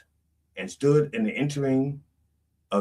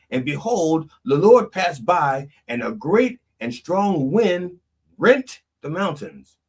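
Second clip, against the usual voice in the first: solemn scripture; 6 semitones higher, 5 dB louder, slower than usual. Acted. Solemn scripture reading, slow.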